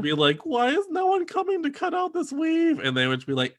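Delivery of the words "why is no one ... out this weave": high-pitched distressed whine; mock wailing complaint. Contestant pleading about her bad weave, high mock wail.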